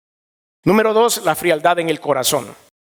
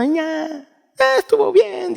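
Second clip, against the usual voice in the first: half-hearted answer; slower than usual. Retold or imitated. Churchgoer answers from mere politeness.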